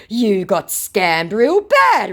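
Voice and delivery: loud, high, slow jeer; mock scammer taunt. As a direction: imitate a hammed-up gloating scammer.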